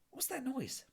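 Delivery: plain reading voice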